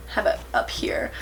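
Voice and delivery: hushed, sheepish aside; embarrassed whisper. Real, not acted. Admitting her fan notifications in a sheepish whisper. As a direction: drop to a whisper, a bit ashamed.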